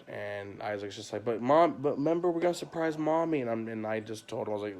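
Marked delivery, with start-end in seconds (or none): 1.2-3.4 put-on higher, eager kid voice pleading; little son pleading. Son begging dad to wait for Mom, lightly voiced kid. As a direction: play the boy begging to stay up.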